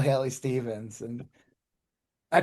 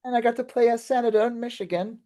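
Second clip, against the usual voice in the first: higher-pitched put-on Cockney; Cockney British; 7 semitones higher, faster than usual. Candidate describing her senator role, Cockney accent. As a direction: chat away as a Cockney actor. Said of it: "it's like Cockney British".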